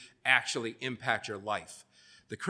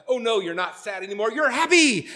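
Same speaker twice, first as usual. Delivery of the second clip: high, exaggerated movie cheer, rising to a loud squeal; hammed-up switch to happy, much higher and louder at the end; mock cheerful; 9 semitones higher, 6 dB louder than usual. Preacher imitating a Disney movie steering feelings, mock cheerful.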